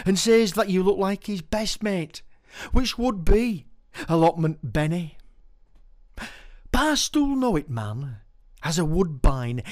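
stage poetry reading; poem recital. Acted. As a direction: perform the verse with relish. Performs his poem.

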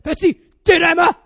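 loud imitated dad calling the dog; dad's commanding shout. Dad calling the puppy in, commanding. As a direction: boom the order across a garden. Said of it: "He's like".

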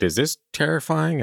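squeaky God showing off his angels; high mock God voice. God asks if it's scary, high and silly.

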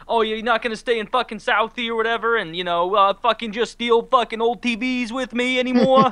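high, loud hammed-up Boston accent; mock Boston accent. Man mocking Affleck's fight scene in a loud Boston accent. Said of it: "lost in whatever accent I'm doing".

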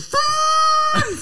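high shouted France!. Acted. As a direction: belt out France! high and loud. Mock French shout.

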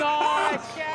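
high squealed "ah", imitating a kid; mock child's scream. Dad imitating his son running from hot sauce, high mock yelp. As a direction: yelp as if the mouth is on fire. Retelling four-year-old running off from spicy wings.

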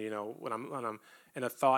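ordinary talk

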